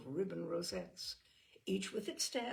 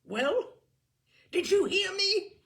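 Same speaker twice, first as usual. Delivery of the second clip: high loud furious yell; shouted; 14 semitones higher, 13 dB louder, slower than usual. A narrator's reading. Simon maddened by Brat's silence, shouting. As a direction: shout to force an answer. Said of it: "shouted Simon".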